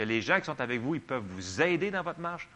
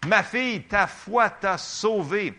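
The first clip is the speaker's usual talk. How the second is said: much higher, louder, slow and solemn; dramatic Jesus voice; 8 semitones higher, 8 dB louder, slower than usual. Acted. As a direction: proclaim it like a grand healer, stretching each word. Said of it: "j'entends des fois dire, ah, moi, je fais".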